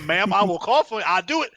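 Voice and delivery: high, loud, laughing playful threat; laughing mock threat. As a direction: cackle through a jokey cough threat.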